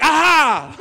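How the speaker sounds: loud gloating exclamation; triumphant aha. Retold or imitated. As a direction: crow at winning the point. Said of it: "we're like".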